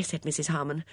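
ordinary delivery, nothing marked

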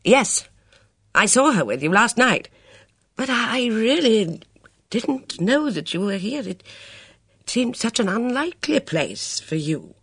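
put-on flustered elderly officer, apologetic; flustered old colonel. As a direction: stammer it out like a caught-out colonel. Luscombe flustered with Bess.

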